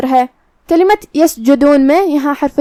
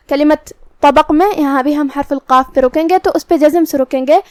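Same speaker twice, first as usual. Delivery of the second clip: usual speaking voice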